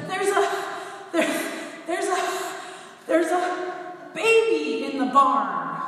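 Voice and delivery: high, loud, gasping stammer of news; breathless announcement. Retold or imitated. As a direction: blurt it out panting, unable to finish. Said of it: "she's panting and she's out of breath".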